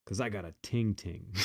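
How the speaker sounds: lightly imitating his Jamaican accent; a hint of the patois driver; mock patois refusal. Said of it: "And he says".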